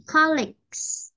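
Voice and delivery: slow "colleagues" with a long final g-s; G then S ending. Shown as a demonstration. Teacher re-modelling for a student, slow "colleagues" ending g-s. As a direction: draw out "colleagues", finish on a clear s. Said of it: "we will say G and then S".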